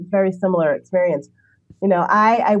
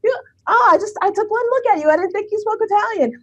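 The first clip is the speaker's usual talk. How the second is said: much higher, fast, sputtering surprise; squeaky mock-flustered Italian; flustered Italian guy; 13 semitones higher than usual. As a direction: play the embarrassed man caught out. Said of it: "He's like".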